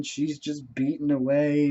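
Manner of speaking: ordinary delivery, nothing marked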